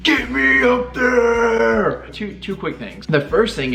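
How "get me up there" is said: normal talking voice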